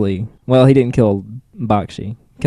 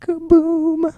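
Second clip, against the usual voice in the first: explosion sound effect. Pretend. Mimicking EMP explosion.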